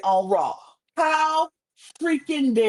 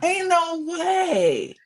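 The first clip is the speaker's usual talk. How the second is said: drawn-out ain't no way; 6 semitones higher, slower than usual. Retold or imitated. Repeated mocking phrase.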